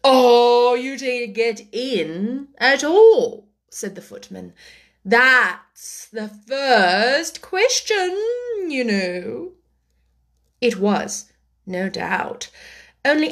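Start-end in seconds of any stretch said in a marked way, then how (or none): 0.0-3.5 slow, drawling footman; unhurried servant, no interest at all. A narrator's reading. Footman voice in story. 5.0-9.5 slow, drawling footman; unhurried servant, no interest at all. A narrator's reading. Footman voice in story.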